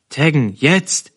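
urgent order. A narrator's reading. Herr Spieß pushes the hesitating operator to act, urgent order. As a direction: press the order home urgently.

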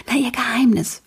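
usual speaking voice

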